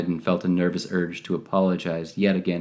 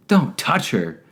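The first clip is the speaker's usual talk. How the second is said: high sharp yell; 5 semitones higher, slower than usual. A narrator's reading. El Gordo stopping Blue from touching the girl, sharp yell. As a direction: yell it to stop him. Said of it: "El Gordo yelled at him".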